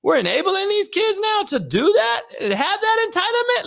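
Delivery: high, disbelieving question; incredulous. Retold or imitated. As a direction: ask it like you can't believe it. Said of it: "I'm like".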